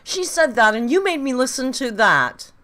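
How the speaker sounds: loud, high, shocked protest; real appalled yelling; appalled outcry. Real, not acted. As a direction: raise your voice and accuse them in disbelief. Appalled at what was played.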